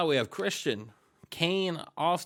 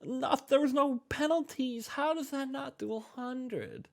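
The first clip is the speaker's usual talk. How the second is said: very high, baffled complaint; incredulous dismay; 11 semitones higher than usual. Real, not acted. Game host disputes the missing hundred, high-pitched and incredulous. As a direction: squeak it out in disbelief.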